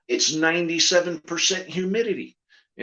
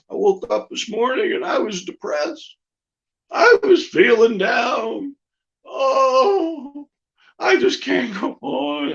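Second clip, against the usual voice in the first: higher, louder hammed-up sobbing; mock sobbing; 8 semitones higher, 4 dB louder, slower than usual. Depressed puppet George hams up crying to his partner, higher. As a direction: whine it out like a weepy puppet.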